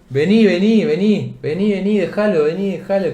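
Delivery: high, nagging stream of calls; insistent repetition. Retold or imitated. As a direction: keep urging him away without stopping. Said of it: "no paraba de decir".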